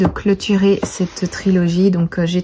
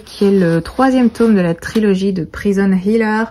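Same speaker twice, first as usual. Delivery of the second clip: ordinary talk, nothing marked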